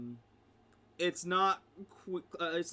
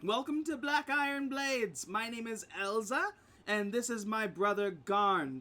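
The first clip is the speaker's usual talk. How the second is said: higher-pitched hostess introduction; welcoming shopkeeper; 4 semitones higher than usual. Acted. Shopkeeper Elza introduces shop and brother, high welcoming voice. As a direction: present the shop in a raised character voice.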